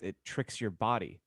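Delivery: ordinary talk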